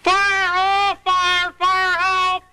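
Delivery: high panicky calls of fire; fluttering cries for help. Shown as a demonstration. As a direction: wave arms and cry out for help. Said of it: "You call out very loudly".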